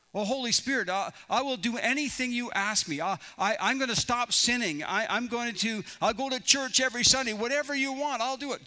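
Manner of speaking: hammed-up begging, tripping over words; Jack. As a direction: babble desperate promises to your rescuer. Jack desperately promises anything.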